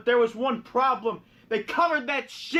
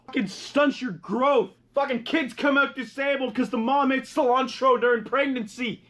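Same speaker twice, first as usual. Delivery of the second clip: normal talking voice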